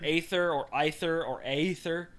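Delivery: "aether or ether or aether" as AY-ther, EYE-ther, AY-ee-ther; listing alternative pronunciations. Shown as a demonstration. Offering co-host the allowed options, listing alternative pronunciations. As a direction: set "aether or ether or aether" apart by first vowel. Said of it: "the way you pronounce that word".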